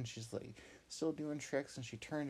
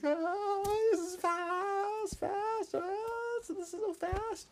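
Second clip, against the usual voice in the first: very high slow squealed fast; thrilled squeal; 6 dB louder, much slower than usual. Acted. Hop racing down the jump, high thrilled squeal.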